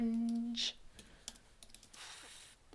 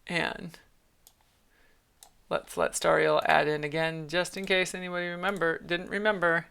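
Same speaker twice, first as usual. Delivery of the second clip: usual speaking voice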